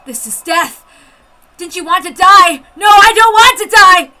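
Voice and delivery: high, loud panicked shout; frantic yell of refusal to die; desperate cry. Acted. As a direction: scream it while running for life. Panicked inner outburst.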